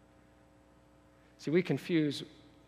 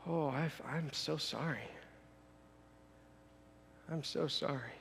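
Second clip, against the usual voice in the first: very soft, pitying apology; condescending pity; 13 dB quieter than usual. Acted. Jesus hearing the man's boasts, hushed pity. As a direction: drop to a quiet, sorrowful sympathy. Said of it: "he's much more condescending than that".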